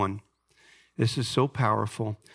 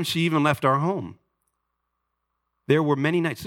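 raised, earnest reading voice; dramatic book reading; 5 semitones higher than usual. Acted. Pastor reads a father's story aloud to the congregation. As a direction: read it to the congregation with weight.

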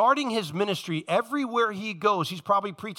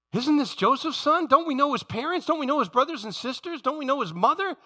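higher, piled-up rising questions; incredulous questioning; 6 semitones higher than usual. Acted. Townspeople doubting Joseph's son, incredulous. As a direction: question him in disbelief, each one lifting.

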